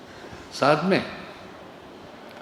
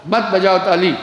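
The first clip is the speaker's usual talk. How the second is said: mildly exasperated, louder command to stop; retold raised-voice order; 3 semitones higher, 5 dB louder than usual. Speaker telling audience to stop clapping, raised voice. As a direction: call over applause, a little louder.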